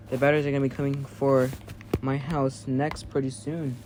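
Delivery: normal talking voice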